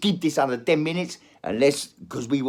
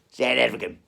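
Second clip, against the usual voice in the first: South African voice; 4 dB louder than usual. Acted. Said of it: "I'll go back to South African".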